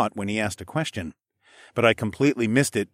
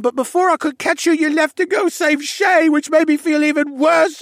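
high, loud, anguished outpouring; distraught boy; 8 dB louder than usual. Acted. Distraught stable boy confesses to Alex, high and loud. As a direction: pour it all out, spiralling in guilt.

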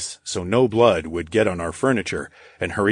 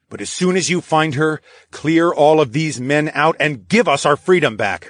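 higher-pitched insistent demand; firm demand; 7 semitones higher, 4 dB louder than usual. Acted. Charles demanding freedom from Edney, insistent. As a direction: press the demand, pitch raised.

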